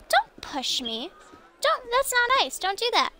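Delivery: high, whiny don't; pretend-offended scolding; mock whiny protest. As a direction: play hurt and scold the game character. NPC pushing player.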